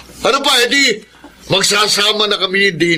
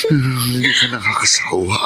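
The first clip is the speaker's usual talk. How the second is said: tender whisper; 6 semitones lower than usual. Acted. Lovers whispering sweet talk.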